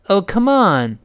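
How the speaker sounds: light "come", long strong "on"; stress on ON. Shown as a demonstration. Teacher models encouragement, stressed "on" in "come on". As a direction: lean on "on" and go lightly over "come". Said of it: "后面那on重读，come略微轻一点".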